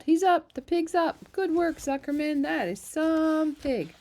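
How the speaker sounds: high, loud, cheering shouts; excited crowd. A narrator's reading. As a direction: cheer the pig on like a fair crowd.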